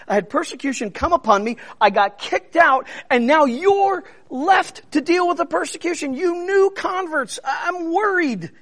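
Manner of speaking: high, fast, anxious pleading apostle; worried Paul. Retold or imitated. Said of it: "This is Paul speaking".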